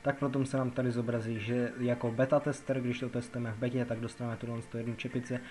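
usual speaking voice

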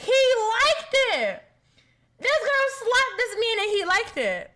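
high, loud, amazed squeal; can't believe he liked it; excited disbelief. Real, not acted. Streamer amazed the slapped man liked it, high squeal.

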